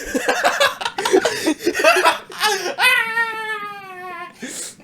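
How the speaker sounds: helpless high-pitched wheezing laugh; laughing so hard it turns into a cry. Real, not acted. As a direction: crack up until it squeaks.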